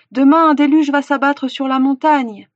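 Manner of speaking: loud, high proclamation of danger; urgent warning. A narrator's reading. Hunter warning villagers of the flood, urgently. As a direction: raise the alarm with full conviction.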